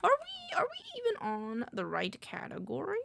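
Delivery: mock-worried question, starting very high; retold self-questioning. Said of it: "I was like".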